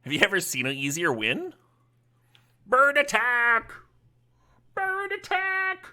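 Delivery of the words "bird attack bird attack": high, slow cartoon cry; silly battle cry. Streamer cheering his bird tokens into the attack, slow and high. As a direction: stretch it out like a goofy war call.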